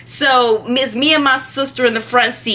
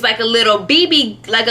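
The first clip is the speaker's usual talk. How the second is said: ordinary talk, nothing marked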